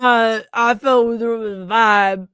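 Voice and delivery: slurred, barely intelligible mock mumble; mumbled imitation. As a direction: imitate a kid who can't speak clearly. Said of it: "coulda gotten Rocky Balboa to speak clearer".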